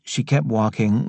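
ordinary delivery, nothing marked

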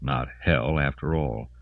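usual speaking voice

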